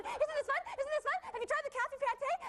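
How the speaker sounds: high-pitched imitated French accent. Reporter imitates a woman from France she met, French accent. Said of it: "she said it in a French accent".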